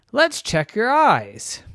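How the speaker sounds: slow, high friendly doctor; a kindly doctor speaking slowly. Acted. Doctor voiced in story.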